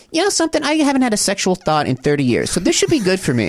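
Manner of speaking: a deadpan confession in a put-on voice; Barbara's aside. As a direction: Barbara blurting out her confession to the founders. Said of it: "Barbara, out of nowhere, goes".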